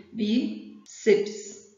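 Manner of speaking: "bíceps" broken in two, pause after "bí"; split into syllables. Shown as a demonstration. Teacher shows students the syllable division, "bíceps" split by a pause. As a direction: say "bíceps" syllable by syllable, bí, then ceps. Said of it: "a divisão silábica seria".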